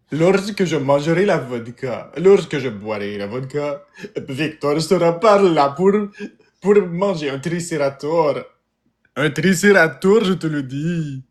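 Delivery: mock Russian accent in French. Shown as a demonstration. Practising dialects for the audience, mock Russian accent. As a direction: put on a thick Russian accent. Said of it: "Parler avec l'accent russe?".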